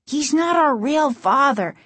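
put-on high-pitched upset boy; urgent high kid's cry. A narrator's reading. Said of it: "Casey cried".

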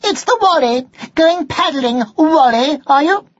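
very high, mocking taunt; sneering. A narrator's reading. Sister mocks Martin at the duck pond, sneering. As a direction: jeer in a high-pitched voice. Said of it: "she sneered".

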